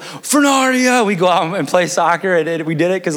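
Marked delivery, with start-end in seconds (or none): 0.0-1.0 high, long-drawn battle cry; hype-up cry. Retold or imitated. Senior hyping the circle before games, rallying cry. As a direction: stretch it into a rousing team chant. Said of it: "hype everybody up".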